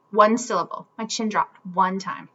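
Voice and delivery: ordinary delivery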